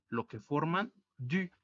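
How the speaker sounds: ordinary delivery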